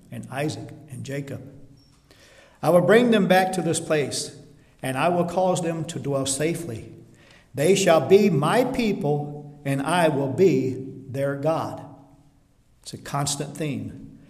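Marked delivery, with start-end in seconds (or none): 2.6-11.8 loud, ringing preaching of the verse; forceful scripture reading. Acted. Preacher booming God's promise to the church. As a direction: proclaim it to the congregation.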